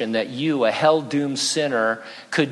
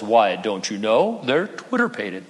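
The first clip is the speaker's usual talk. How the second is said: Owl; 5 semitones lower than usual. Retold or imitated. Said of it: "Owl says".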